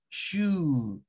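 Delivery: "shu" said slowly and clearly; correct pronunciation. Shown as a demonstration. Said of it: "no, es shu".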